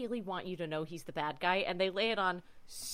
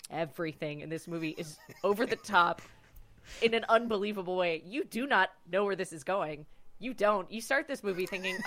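normal talking voice

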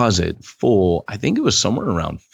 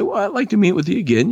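high, fast and eager; friendly invitation; 12 semitones higher, faster than usual. Retold or imitated. Minister asking the Klansman to meet again, high and fast. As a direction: keep the door open warmly. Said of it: "He'd say".

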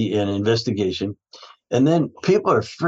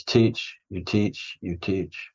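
fading to a much softer teach; dying breath, trailing off; dying words; 3 semitones lower, 6 dB quieter, much slower than usual. Retold or imitated. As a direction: repeat it softer and softer, like a man on his deathbed. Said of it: "on his deathbed".

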